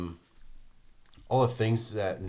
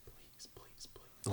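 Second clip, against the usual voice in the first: soft whispered please, please, please; whispered pleading; 19 dB quieter, much slower than usual. Retold or imitated. Imitating Sam whispering please at the shower. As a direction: beg in a tiny breathless whisper. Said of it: "And he's like".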